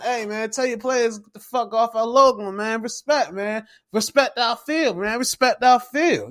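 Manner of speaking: high-pitched imitation of an angry coach; hammed-up coach scolding opponents; pissed-off coach. Coach telling players off the logo, high mock anger. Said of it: "he come pissed off".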